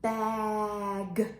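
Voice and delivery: BAG held long, G released separately; slow level Bag with a detached G; stretched-out word. Shown as a demonstration. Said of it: "let's stretch it out".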